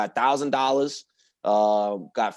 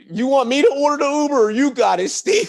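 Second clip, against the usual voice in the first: eager; 9 semitones higher, 4 dB louder than usual. Retold or imitated. Eager retold offer to Steve.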